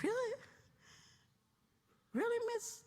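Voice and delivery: high-pitched imitation of disbelief; incredulous youngster. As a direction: question it like an astonished kid. Acted young man's disbelief.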